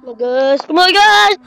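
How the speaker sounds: ordinary talk, nothing marked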